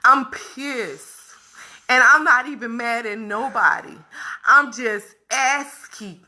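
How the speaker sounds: ordinary talk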